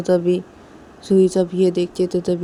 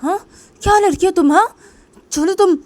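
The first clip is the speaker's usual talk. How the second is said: high, loud angry order; angry shouting; 10 semitones higher, 13 dB louder than usual. Acted. Piu ordering Rui out, angry shouting. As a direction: shout her out of the room.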